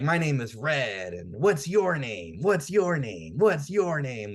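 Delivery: imitating an over-eager kid, pitch swooping up; excited pestering. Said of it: "Red's just so excited".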